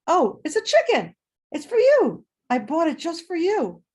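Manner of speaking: grandma's voice. Retold or imitated. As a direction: play the cheery grandma handing over a gift.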